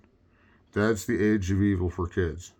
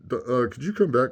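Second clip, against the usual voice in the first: usual speaking voice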